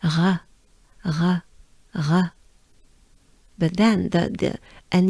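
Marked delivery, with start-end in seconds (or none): 0.0-2.2 "re" with a French R, breathed out. Shown as a demonstration. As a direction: exhale on "re" with the tongue kept down. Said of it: "pronouncing the French R".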